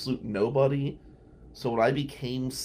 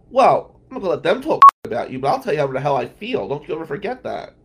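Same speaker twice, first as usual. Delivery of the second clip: loud, fast and fired up, higher at the start; heated outburst; 4 semitones higher, 12 dB louder, faster than usual. Retold or imitated. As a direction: blast it out like she's telling him off. Retold friend's heated outburst.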